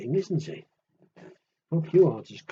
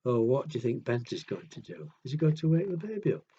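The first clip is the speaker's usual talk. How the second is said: plain reading voice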